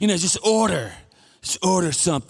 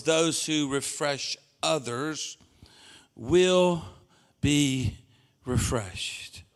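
slow, softer, each word set apart; slow scripture emphasis; 4 semitones lower, 6 dB quieter, much slower than usual. Acted. Quoting Proverbs to the church, slow and weighty. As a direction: linger on every word of the verse. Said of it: "Tells us in Proverbs".

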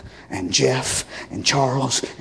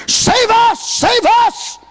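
high, loud plea of save us; shouted high plea; crowd's cry; 4 dB louder, slower than usual. Acted. Crowd cries out.

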